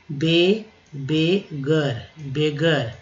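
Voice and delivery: Beggar drawn out very slowly, be-gar; very slow drawn word. Shown as a demonstration. Teacher slows beggar down for students. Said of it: "double जी का इस्तेमाल किया गया".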